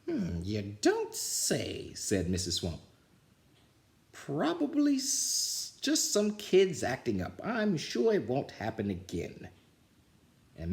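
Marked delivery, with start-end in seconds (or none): 0.1-1.9 slow, dry scratchy murmur; disguised witch fooling the principal; sly scratchy voice. Acted. Swamp disguised. 4.1-9.5 very high, slow fake teacher voice; false sweet voice. Swamp faking Nelson.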